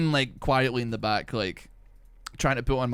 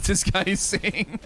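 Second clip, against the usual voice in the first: breaking into laughter, pitched up; higher voice giving way to laughter; laughing; 11 semitones higher, slower than usual. Retold or imitated. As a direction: laugh through the words, voice climbing. Laughs at people not understanding him.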